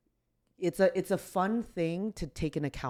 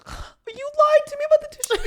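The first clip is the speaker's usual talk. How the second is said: much higher and louder, laughing out the words; laughing through tears; 11 dB louder than usual. Real, not acted. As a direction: burst into teary laughter. Teary laughing accusation to friend.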